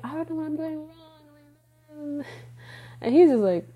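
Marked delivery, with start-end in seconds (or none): 0.0-0.9 high, soft helpless voice; helpless plea. Retold or imitated. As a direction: plead with a lost high tone. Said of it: "I'm like".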